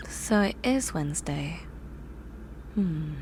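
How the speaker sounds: breathy whispered realization, to herself. Acted. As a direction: whisper it under your breath. Realizing it is already Wednesday.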